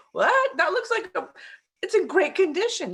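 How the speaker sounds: high-pitched, incredulous reaction; astonished disbelief. Retold or imitated. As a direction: react as if the bargain can't be true. Partner's astonishment at low price, retold.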